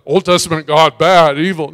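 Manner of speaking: loud caricature of a complaint; mocking critics. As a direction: parody the critics at full volume. Mimics critics of Old Testament God.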